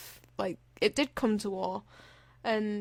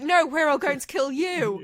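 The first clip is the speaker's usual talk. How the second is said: mock menacing Sony; 4 semitones higher, 5 dB louder than usual. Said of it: "Sony was like".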